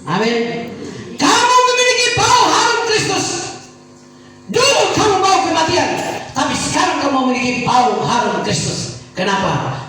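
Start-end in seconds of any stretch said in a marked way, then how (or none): 1.1-9.0 much higher and louder, ringing preacher's cry; preaching at full force. Acted. Pastor proclaiming to congregation, full-force preaching. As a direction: proclaim it to the whole church.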